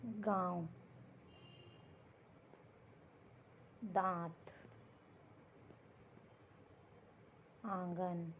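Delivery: गाँव, दांत, आंगन said slowly with nasal vowels; nasal examples. Shown as a demonstration. Teacher giving students nasal example words. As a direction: let गाँव, दांत, आंगन ring through the nose. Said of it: "जैसे देखिए".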